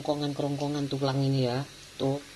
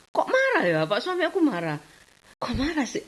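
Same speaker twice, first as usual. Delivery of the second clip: incredulous; 11 semitones higher than usual. Retold or imitated. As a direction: pretend bafflement at his anger. Wondering why husband angry. (heran pura-pura, nada tinggi: "Kok marah sih?")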